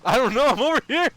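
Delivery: high, fast, loud outburst; exasperated yell. Real, not acted. Streamer protesting he can't check on Huddy, yelling. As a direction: shout it back, high and rushed.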